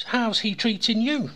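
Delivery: lightly voiced, lifted inquiry; joke's Englishman. Englishman questions horse in joke.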